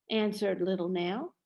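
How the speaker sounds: plain reading voice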